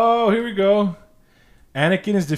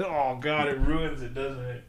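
normal talking voice

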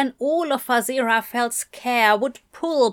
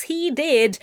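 ordinary delivery

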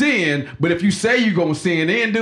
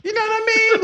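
laughing. Real, not acted. Joking about grandma, high laughing.

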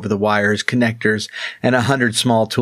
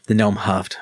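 usual speaking voice

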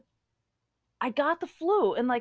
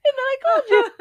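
high, loud, tearful laughing; crying with laughter; 10 semitones higher, 7 dB louder, much faster than usual. Real, not acted. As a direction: squeal it through helpless laughter. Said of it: "we're crying laughing".

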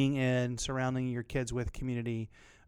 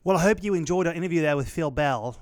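louder, hammed-up British accent; put-on British accent; 2 semitones higher, 5 dB louder than usual. Podcast host back from the interview, badly faked British accent. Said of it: "you gotta work on that accent".

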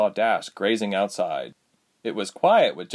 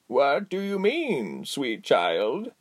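put-on slow tender old woman; 5 semitones higher, much slower than usual. Giselle tender to girl.

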